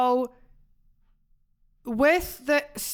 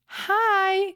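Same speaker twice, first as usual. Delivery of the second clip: imitating [name]'s accent; 9 semitones higher than usual. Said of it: "you know, with her accent".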